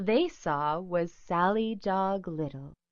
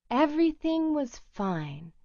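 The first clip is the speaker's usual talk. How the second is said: ordinary delivery, nothing marked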